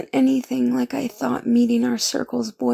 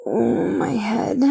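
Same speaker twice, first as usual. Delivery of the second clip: pained groan; slower than usual. Acted. Waking with a hangover.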